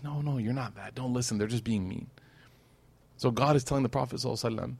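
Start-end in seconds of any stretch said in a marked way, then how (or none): 0.0-2.1 put-on much softer, gently comforting a child; soft reassuring parent. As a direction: soothe the hurt kid quietly. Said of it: "the mom says, the dad says".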